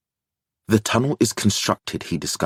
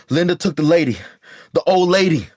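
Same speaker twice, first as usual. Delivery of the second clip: high, urgent and out of breath; panting alarm; 10 semitones higher, 4 dB louder, faster than usual. A narrator's reading. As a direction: gasp it out in a panic. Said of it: "Mateo pants".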